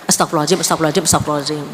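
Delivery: rattled off fast and low, half-hearted; hasty throwaway prayer; rushed careless prayer. Retold or imitated. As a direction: mumble it through in a hurry to be done. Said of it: "doanya ala kadarnya".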